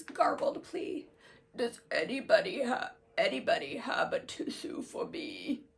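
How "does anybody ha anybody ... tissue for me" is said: sick flea voice. Acted. Sick flea asks for a tissue.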